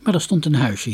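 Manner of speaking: plain reading voice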